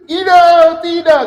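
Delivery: high, loud strained groaning no, dragged slowly; hammed-up anguished uh, uh, tidak; mock stressed person. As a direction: act a person straining in distress. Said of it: "Contoh begini".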